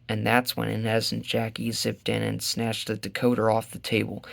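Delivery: ordinary delivery, nothing marked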